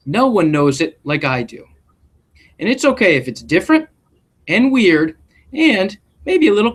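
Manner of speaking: gentle storybook read-aloud voice; expressive classroom story reading; reading to children. A narrator's reading. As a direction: read it like a picture book to a class. Reads picture book aloud.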